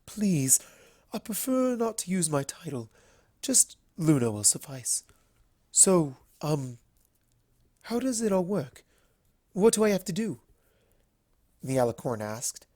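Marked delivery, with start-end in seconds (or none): 0.0-10.4 a light, higher, stately princess voice; gently turning down an offer, royally; regal princess Luna. A narrator's reading.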